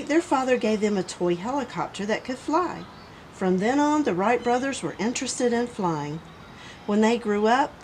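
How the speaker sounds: usual speaking voice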